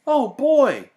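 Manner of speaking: put-on gleeful child's oh boy; excited boy. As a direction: squeal it like a thrilled little boy. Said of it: "said Sam".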